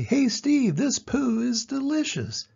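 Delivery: high, slow, savouring voices; beetle friends. Acted. Fellow beetles praise the poo, high and savouring. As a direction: relish every word high up. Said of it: "The other dung beetles say".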